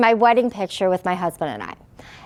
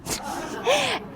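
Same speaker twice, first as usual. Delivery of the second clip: bitter laugh; 11 semitones higher, 9 dB quieter than usual. Real, not acted.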